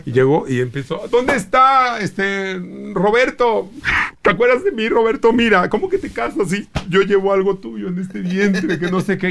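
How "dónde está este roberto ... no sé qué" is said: woman character voice. Retold or imitated. Comedian in drag confronting the groom at bachelor party, falsetto. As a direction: play the jilted woman, hammed up. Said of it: "llego yo de mujer".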